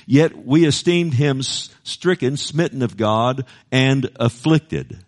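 slow, emphatic reading of the verse; solemn scripture reading. Acted. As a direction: lean on each word of scripture. Reads verse again emphatically.

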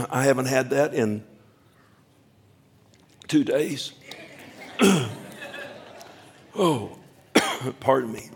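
ordinary talk

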